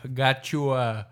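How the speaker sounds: slow, lightly voiced New York Italian guy. Quoting the dude from the video, lightly put-on Italian-American accent. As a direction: draw out a mock New York Italian gabagool. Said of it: "that one video the dude".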